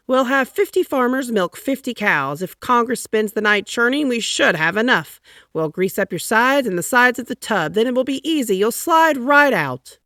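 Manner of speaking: high, loud, enthusiastic scheme; folksy agriculture secretary. A narrator's reading. Secretary of Agriculture proposing a butter plan to Taft. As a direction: pitch the butter plan eagerly.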